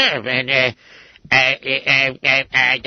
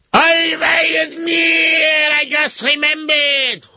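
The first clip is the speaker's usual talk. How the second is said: excited realization; 10 semitones higher, much slower than usual. Acted. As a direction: burst out as it suddenly comes back. Sudden recollection.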